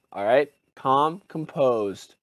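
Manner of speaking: mock calm. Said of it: "you're gonna be like".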